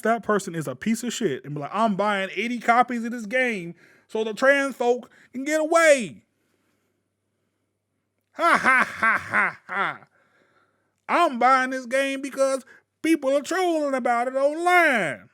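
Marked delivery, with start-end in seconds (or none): 1.7-6.2 mock Southern troll from Alabama, loud and rising; Alabama bigot. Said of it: "my racist, sexist person sound like they from Alabama". 8.3-10.1 loud, forced "ha ha" falling away; hammed-up troll laughter; mock bigot laugh. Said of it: "my racist, sexist person sound like". 11.1-15.3 loud, higher mock Alabama drawl; mock Southern troll from Alabama, loud and rising; Alabama bigot. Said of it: "my racist, sexist person sound like they from Alabama".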